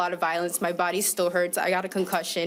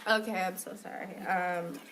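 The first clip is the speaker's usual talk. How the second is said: tearful apology; 7 dB quieter than usual. Real, not acted. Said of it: "I'm getting a little emotional".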